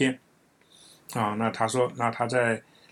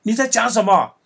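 indignant, higher and louder outburst; angry retort; 9 semitones higher, 5 dB louder than usual. Retold or imitated. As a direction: play the offended friend, voice raised in protest. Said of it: "他就很生气".

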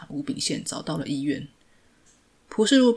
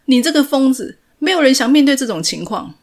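much higher, much louder scream; furious yell; 9 semitones higher, 11 dB louder than usual. A narrator's reading. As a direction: yell at him in anger. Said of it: "对他吼着". (愤怒地吼叫)

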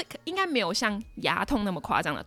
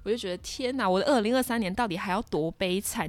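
usual speaking voice

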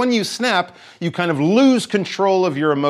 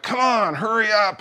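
higher-pitched mock impatience; impatient; 7 semitones higher than usual. Frustrated person in line urging others, mock impatient. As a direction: nag them to hurry, half-acted. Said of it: "If you're very frustrated".

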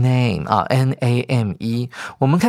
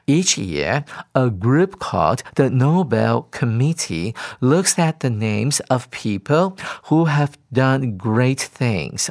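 slow text reading; slower than usual. Acted. Teacher reads English text aloud.

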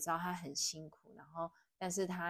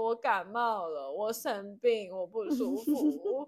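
high, slow, half-sobbing mock plea; imitating herself crying, high and dragged out; retold tearful plea; 4 semitones higher, 4 dB louder, slower than usual. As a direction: replay the crying words slowly, voice pitched up. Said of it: "然后就大哭".

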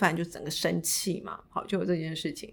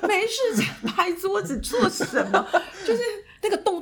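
laughing; 13 semitones higher, 4 dB louder than usual. Real, not acted. Laughing at her own outburst.

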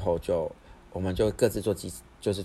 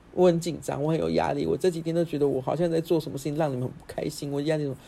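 imitating crying brother; 8 semitones higher than usual. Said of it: "我弟就爆哭".

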